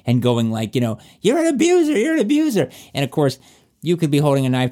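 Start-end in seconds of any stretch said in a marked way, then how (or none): 1.2-2.7 high, shrill-free mocking shriek of blame; mock accusing abuser. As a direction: parody the abuser yelling the accusation, pitched way up.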